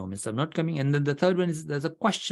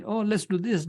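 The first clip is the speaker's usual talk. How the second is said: imitating a newcomer, pitched much higher; mock eager newcomer; 8 semitones higher than usual. Visiting member pushing their old club's habits, mock eager and high. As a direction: chirp it like a keen visitor from another club. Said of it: "you want to say".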